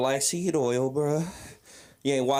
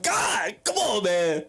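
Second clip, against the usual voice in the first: pleading to God; 6 semitones higher, slower than usual. Retold or imitated. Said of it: "I looked up in the sky".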